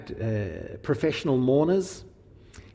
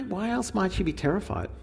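normal talking voice

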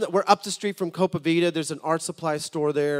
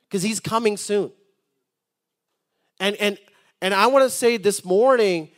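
rising, fervent pulpit preaching, louder than his storytelling; 4 semitones higher, 7 dB louder than usual. Acted. Pastor preaching to the congregation about God calling America, fervent sermon delivery. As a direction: stir the congregation awake with full-throated preaching.

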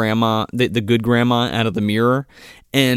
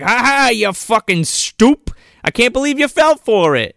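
mocking gloat; 9 semitones higher, 3 dB louder than usual. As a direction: rub it in like a cartoon villain. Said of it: "And then she's like".

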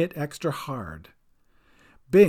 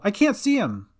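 higher, worried call; 6 semitones higher than usual. A narrator's reading. Cat searches from the boat, worried call. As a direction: call back anxiously. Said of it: "she called".

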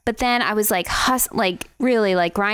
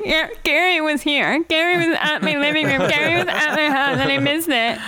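mock crying; 5 semitones higher than usual. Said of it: "Just crying".

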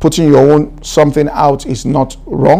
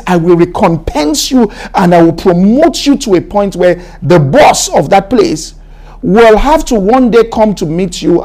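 impassioned preaching; 6 semitones higher than usual. Acted. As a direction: preach it out with full fervour to the congregation. Preaching at full force.

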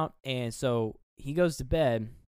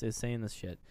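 normal talking voice

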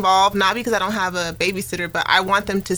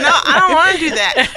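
laughing; 8 semitones higher, 7 dB louder than usual. Retold or imitated. Laughing refusal of husband's plans.

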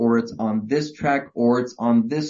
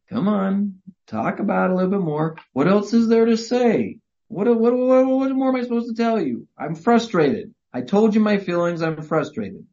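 frustrated husband; 7 semitones higher than usual. Retold or imitated. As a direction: act out the cornered husband running out of words. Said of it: "And you're like".

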